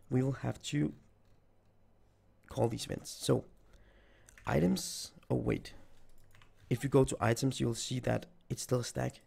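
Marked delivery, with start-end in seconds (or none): none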